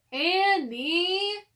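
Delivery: loud, high call of a name. A narrator's reading. Brother calling up to the treehouse, loud high call. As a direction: call up after her.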